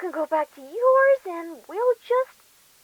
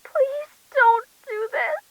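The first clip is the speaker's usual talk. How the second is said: desperate plea; 7 semitones higher, slower than usual. Acted. Victim begging before the cut.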